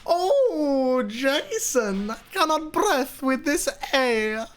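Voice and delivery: high, slow mock Latvian accent; Marilyn's accent. Mimicking his friend in the video, hammed-up Latvian accent. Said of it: "And that's how he spoke the entire time".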